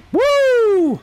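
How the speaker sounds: long, high "woo"; excited whoop. Real, not acted. Gamer racing as Metal Knuckles, long high whoop. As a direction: whoop as you speed away. Speeding along in the game.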